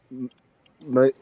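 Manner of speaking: ordinary talk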